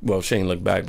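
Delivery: ordinary talk, nothing marked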